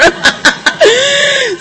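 a big delighted burst of laughter; triumphant cackle. Real, not acted. Bursts out laughing at her trick.

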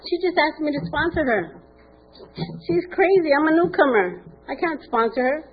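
high, eager and pleased with herself; giddy newcomer crowing to her sponsor; excited bragging. Retold or imitated. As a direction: show off the news, voice up high.

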